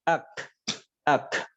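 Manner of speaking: ak ending in an audible breath release; sukun ak. Shown as a demonstration. Teacher demonstrating sukun kaf, audible breath. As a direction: release air clearly after ak. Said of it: "lebih jelas terdengar".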